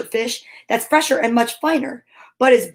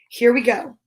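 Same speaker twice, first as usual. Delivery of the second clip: ordinary delivery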